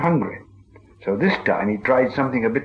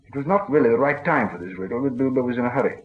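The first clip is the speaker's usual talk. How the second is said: plain reading voice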